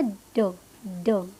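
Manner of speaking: ड with the tongue at the upper teeth; a short, low ड, tongue touching the teeth; correct D sound. Shown as a demonstration. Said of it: "तो correct क्या है".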